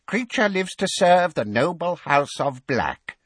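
high, loud declaration of devotion; servile elf. Acted. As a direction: proclaim loyalty in an elfish voice. Servile elf answers master.

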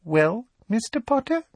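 put-on very high girlish prompt; sugary, expectant question up high; falsely sweet. A narrator's reading. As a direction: prompt sweetly, ending on a rise. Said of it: "a falsely sweet, girlish voice".

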